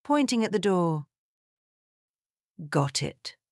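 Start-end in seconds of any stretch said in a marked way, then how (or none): 2.7-3.4 low, resigned sigh of words. A narrator's reading. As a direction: say it as a restrained sigh. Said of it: "Vivian sighed".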